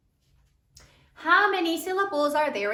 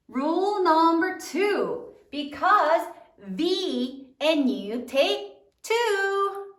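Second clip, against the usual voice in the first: drawn-out classroom chant; slow, emphatic teacher recitation; slow recited rule; 3 semitones higher, much slower than usual. Shown as a demonstration. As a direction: stretch each word like a memory rule for the class.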